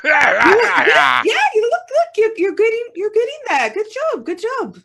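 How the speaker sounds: calm the angry dwarf down; high placating voice. Acted. Entropy placating loudly.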